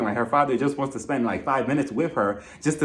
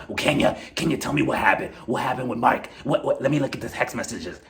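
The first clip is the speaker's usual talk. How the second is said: lower, put-on nosy friend voice; mock Marlo voice; 5 semitones lower than usual. Vlogger imitating Marlo reading Kenya's texts, lower put-on voice. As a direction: play Marlo as a fake-shocked busybody.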